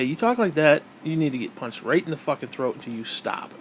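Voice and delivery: ordinary delivery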